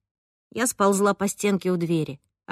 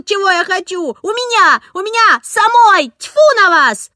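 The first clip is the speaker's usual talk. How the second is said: put-on very high, very loud child's scream; girl screaming at the arguing adults; screaming at the top of her voice; 11 semitones higher, 7 dB louder than usual. A narrator's reading. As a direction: shriek it out, fed up with everyone. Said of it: "завопила, что было мочи".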